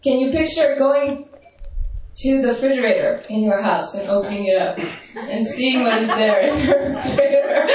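ordinary delivery

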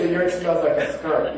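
ordinary talk

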